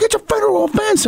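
hammed-up outraged spouse; scolding wife. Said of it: "she's like".

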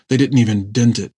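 ordinary delivery

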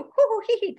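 high, quick put-on "hoo hoo hee hee"; mock giggly squeals. Host joking about what the guys want, mock giggly squeals.

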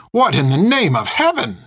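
high, indignant exclamation; scandalized outburst; swearing in shock. A narrator's reading. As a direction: burst out appalled, pitch swooping up. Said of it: "she swore".

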